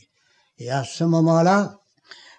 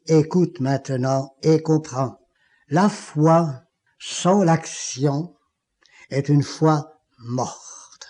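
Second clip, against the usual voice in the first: lent, solennel, détaché; voix du Seigneur; slower than usual. Retold or imitated. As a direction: chaque mot posé lentement, avec gravité. (la voix divine qui parle lentement et gravement)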